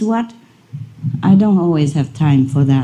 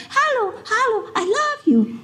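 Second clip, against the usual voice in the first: much higher, softer dog voice saying hello; dog imitation; 6 dB quieter than usual. Imitating the dog greeting its owner, high and soft. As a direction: squeak it like the talking dog. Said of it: "And sometimes he said".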